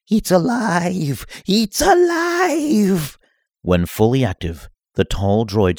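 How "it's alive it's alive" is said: high, slow, drawn-out triumphant cry; mad scientist cry. Acted. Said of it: "The self-proclaimed Star Wars expert said".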